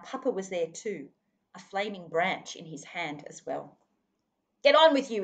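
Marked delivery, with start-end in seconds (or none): 4.6-5.2 loud, commanding bellow; father bellowing at the dingoes; thundering. A narrator's reading. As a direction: roar the dogs away. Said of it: "he thundered".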